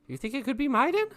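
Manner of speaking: tentative hunch in a high character voice; high-pitched character voice. Acted. Player speaks as their character.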